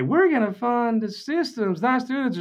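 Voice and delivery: high, mocking sing-song of the other side; mock slogan voice. Mocking rivals' school funding slogan in a high voice. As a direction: sneer their slogan back at them.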